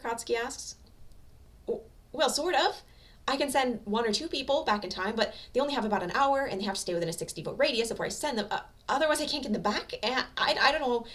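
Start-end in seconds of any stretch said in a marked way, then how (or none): 1.7-11.1 higher, stammering nervous woman. A narrator's reading. Time-travel woman explaining her quirk, nervous stammer. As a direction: stumble through it, flustered.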